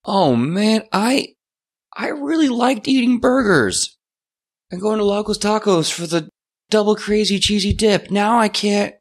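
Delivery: high-pitched, hammed-up disappointment; dismayed lament. New vampire laments losing burgers, high-pitched dismay. Alex mourns food he cannot eat.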